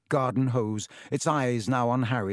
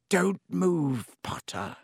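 lazy drawl; 5 semitones higher, slower than usual. A narrator's reading. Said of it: "said Snape lazily".

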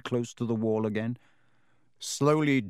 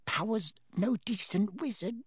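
high, softer, hushed warning; urgent whisper; 7 semitones higher, 5 dB quieter than usual. A narrator's reading. Elf confiding a dark secret to Harry, urgent whisper. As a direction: drop to an urgent hush. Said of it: "voice dropped to an urgent whisper".

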